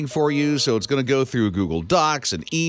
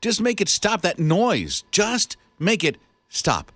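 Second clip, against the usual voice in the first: higher-pitched, exasperated begging; mock pleading; 6 semitones higher than usual. Radio host acts tormented by a noise, mock pleading.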